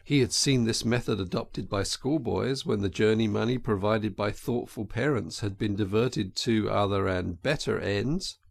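plain reading voice

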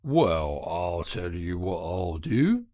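low working-man voice; kindly engine driver. Acted. Good engine driver makes the washerwoman an offer, low.

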